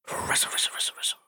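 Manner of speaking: soft breathy rustle rustle; rustling whisper. Shown as a demonstration. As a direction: whisper it like leaves in wind. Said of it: "Can you do a rustling sound?".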